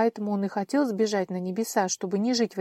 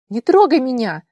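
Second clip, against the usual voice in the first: high, loud, voice cracking scream; breaking scream; 6 semitones higher, 12 dB louder than usual. Acted. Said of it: "срывающимся голосом крикнула она".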